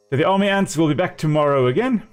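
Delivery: usual speaking voice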